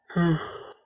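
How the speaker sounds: a long tired sigh; weary sigh. Acted. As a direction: let out a heavy, worn-out sigh. Kushina worn out, missing daughter.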